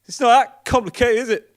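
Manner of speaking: high, loud indignant parting shot; shouting back. Acted. Humiliated passenger waddling off, shouting back at driver. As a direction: call it back loudly and high. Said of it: "I have to shout back".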